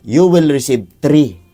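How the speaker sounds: each word drawn out, firm; slow warning. Acted. Father warning the child of the condition, slow and firm. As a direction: spell out the condition slowly.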